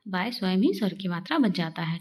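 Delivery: ordinary talk, nothing marked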